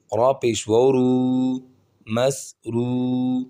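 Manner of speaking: slow, measured syllable-by-syllable drill; spelling-drill recitation. Shown as a demonstration. Quran teacher spelling words for learners, measured recitation. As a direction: draw out the long syllables evenly.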